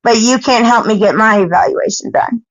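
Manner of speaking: ordinary talk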